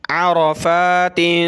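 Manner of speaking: अरफ़ातिन slow, with the ending tin hummed through the nose; nasal tanween. Shown as a demonstration. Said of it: "नाक में आवाज़ पैदा करके किस तरह पढ़ेंगे".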